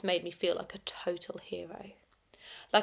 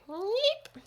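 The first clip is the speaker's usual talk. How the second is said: startled high yeep; a tiny embarrassed squeak; 14 semitones higher than usual. A narrator's reading. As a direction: squeak it out, caught off guard. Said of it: "I let out a noise that sounded a bit like".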